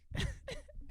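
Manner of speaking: sudden high-pitched laugh. Real, not acted. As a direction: burst out in a squeaky laugh. Laughs at the gas guy.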